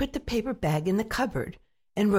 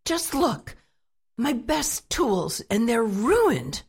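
higher, slow outraged complaint; shouted; 5 semitones higher, 4 dB louder, slower than usual. A narrator's reading. Husband shows his wife the ruined tools, slow angry shout. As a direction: drag it out in angry disbelief. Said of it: "he shouted".

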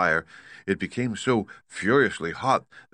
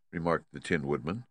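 ordinary delivery

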